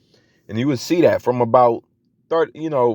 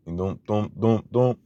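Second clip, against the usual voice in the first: beat boxing; 4 dB quieter, slower than usual. Retold or imitated. Imitating the beat dropping.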